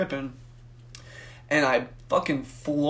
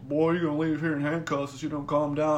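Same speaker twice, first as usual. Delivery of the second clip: lightly voiced guard giving a warning; hint of a stern security guard. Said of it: "like, security guard. He's all".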